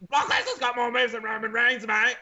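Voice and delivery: high, slowed broad Australian pub voice; broad Australian accent. Retold or imitated. Pub fan arguing about wrestling, broad Australian accent. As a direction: play a rowdy Australian bloke arguing. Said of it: "putting on an Australian accent".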